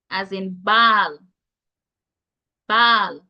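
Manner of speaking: ball said with a long open ah, baal; Jamaican pronunciation. Shown as a demonstration. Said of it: "It represents the pronunciation".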